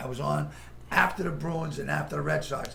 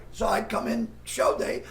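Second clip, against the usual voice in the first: ordinary talk, nothing marked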